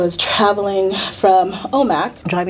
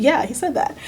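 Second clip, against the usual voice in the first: ordinary delivery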